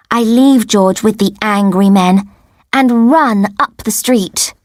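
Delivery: ordinary delivery, nothing marked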